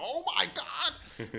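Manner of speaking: high, drawn-out squeal of delight; mock amazed squeal. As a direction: act out a swooning shriek, stretching each word. Retelling reaction to models undressing.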